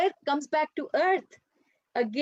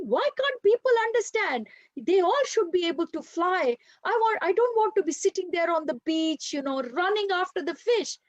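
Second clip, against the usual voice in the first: high, frustrated complaining bird; upset young bird; 6 semitones higher than usual. Acted. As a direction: whine like a frustrated outcast.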